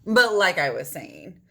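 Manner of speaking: ordinary delivery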